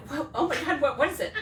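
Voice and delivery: high, loud gasp of alarm; shocked. Retold or imitated. To her friend Anna, alarmed at the ambulance story. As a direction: pretend to be horrified by the news. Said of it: "And I'm like".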